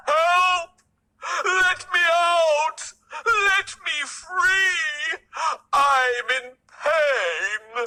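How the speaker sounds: trapped lion begging to be freed; roaring for help. A narrator's reading. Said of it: "his roaring and crying".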